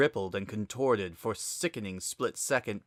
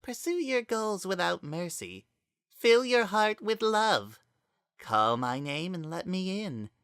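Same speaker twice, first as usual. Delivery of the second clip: higher, eerie possessed voice speaking through Azriel; a dark spirit wearing a friend's voice, higher; possessed Kara voice; 7 semitones higher than usual. A narrator's reading. Kara confronts Frisk, speaking as eerie higher voice.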